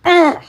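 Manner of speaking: loud, high angry "ugh"; frustrated groan. Real, not acted. Streamer beyond mad on a livestream, frustrated groan. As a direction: burst out with an exasperated groan. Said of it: "I am so mad right now".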